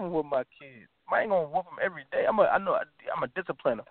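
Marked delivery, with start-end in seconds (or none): none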